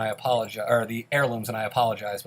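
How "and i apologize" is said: normal talking voice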